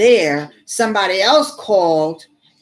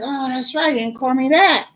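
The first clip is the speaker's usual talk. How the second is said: retold realization; 7 semitones higher than usual. Sudden realization.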